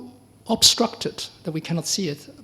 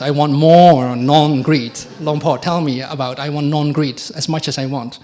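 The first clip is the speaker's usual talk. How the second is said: greedy demand; 8 dB louder than usual. Retold or imitated. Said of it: "that's how greedy I was".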